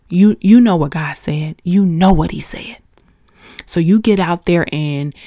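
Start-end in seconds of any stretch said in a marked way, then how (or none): 2.1-2.8 a real whisper, pressing the point; whispered reminder to the listeners; urgent whisper. Real, not acted. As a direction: lean in and whisper it. Urging listeners, sermon climax.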